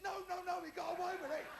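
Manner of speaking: excited mock insistence; insistent denial. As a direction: jump in to overrule the sympathy. Insists once more.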